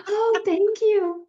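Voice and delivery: very high, loud, drawn-out gushing thanks; exaggerated appeasing thanks. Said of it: "Mine would have been like".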